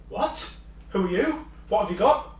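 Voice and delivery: high-pitched baffled questions; acted bewilderment. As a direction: play a leader caught off guard. Acts out stunned reply to aliens.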